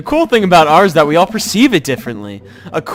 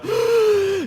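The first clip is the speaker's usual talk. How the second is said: mock gasp; 5 dB quieter than usual. [name] gasps mid art rant.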